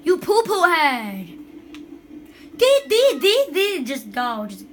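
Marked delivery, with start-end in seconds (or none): 0.0-1.3 put-on loud, drawn-out childish wail; playful tantrum yelling; whiny outburst. Upset at opponent. 2.6-3.9 high, yelled staccato syllables; loud "deet" chant. Pretend. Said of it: "I'm just yelling".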